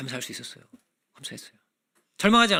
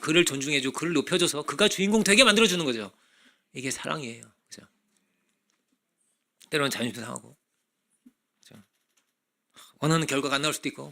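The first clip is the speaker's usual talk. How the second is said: usual speaking voice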